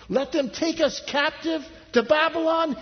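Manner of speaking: very high incredulous question; high-pitched outraged astonishment; appalled disbelief. Acted. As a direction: protest as if it were unthinkable. People object at the climax.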